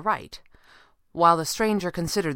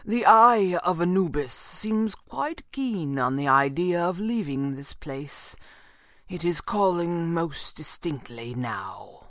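slow, measured character voice; assured mysterious stranger; 2 semitones higher, slower than usual. A narrator's reading. Stranger explains the amulet's calling, slow and assured. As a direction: let each phrase unroll slowly, quite certain.

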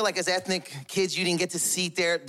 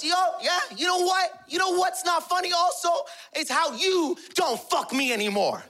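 high-pitched wife hitting back; angry comeback; 9 semitones higher than usual. Retold or imitated. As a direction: fire back indignantly in a high voice. Mother retaliates in quarrel.

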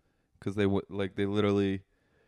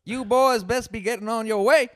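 Southern cop; 12 semitones higher, 6 dB louder than usual. Pretend. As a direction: warn them off like a Southern cop. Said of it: "turning into a Southern cop".

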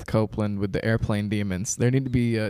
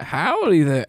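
put-on southern cowboy accent; 3 semitones higher, 4 dB louder, slower than usual. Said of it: "comes on with a cowboy hat".

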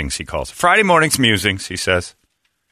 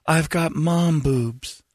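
put-on girlfriend voice; 5 semitones higher, 3 dB quieter, much slower than usual. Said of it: "she goes".

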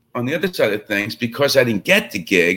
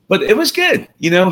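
ordinary talk

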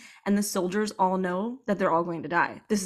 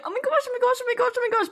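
rapid, much higher excited chant; squealing into fist; 13 semitones higher, much faster than usual. Retold or imitated. Reader reacting to each sentence with high squeals. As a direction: squeal over and over, barely containing it. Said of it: "I'm screaming into my fist".

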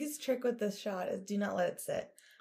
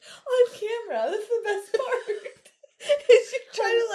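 laughing; 14 semitones higher, 8 dB louder than usual. Real, not acted. Teasing mom while laughing.

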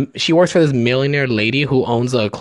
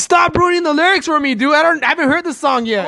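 mock complaint in a much higher voice; whiny protest; 11 semitones higher than usual. Passenger begging the singer to stop spoiling lyrics, whiny protest. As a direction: act out an annoyed passenger, pitched way up. Acts out annoyed passenger in car.

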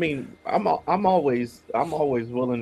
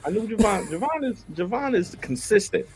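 excited; 10 semitones higher than usual. Real, not acted. Playful praise of viewer.

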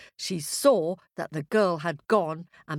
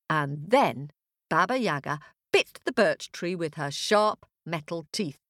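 usual speaking voice

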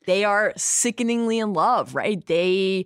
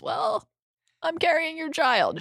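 mock bashful reveal; 10 semitones higher than usual. Voicing Talisa's announcement.

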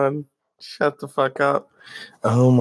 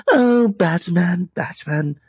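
high, drawn-out Joker sigh; lingering Joker longing; 7 semitones higher, 5 dB louder, slower than usual. Pretend. Joker sighing for Batman, high and slow.

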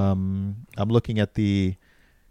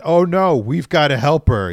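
raised, loud cry of fake dismay; mock alarm; 5 semitones higher, 8 dB louder than usual. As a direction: play useless rescuers suddenly panicking. Mocking inept men's alarm.

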